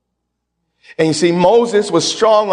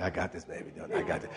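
unvoiced hush, intimate and close; whispered tender God voice; whispered reassurance; 12 semitones lower, 21 dB quieter, faster than usual. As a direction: whisper it like a secret promise.

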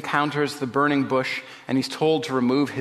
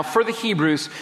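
normal talking voice

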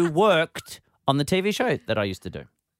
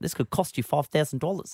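imitated New Zealand accent; 4 dB quieter than usual. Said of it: "I read it with their adorable accent".

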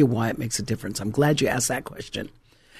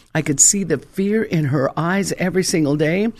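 ordinary delivery

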